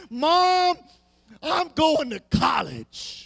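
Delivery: ordinary talk, nothing marked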